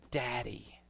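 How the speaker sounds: breathy whisper of Daddy. Shown as a demonstration. A pastor showing how tender Abba sounds, whispered. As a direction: breathe it like a child.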